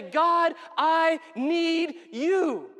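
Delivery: loud, high, drawn-out cry to God; impassioned plea. Acted. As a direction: call out slowly, word by word. Preacher acts out desperate prayer.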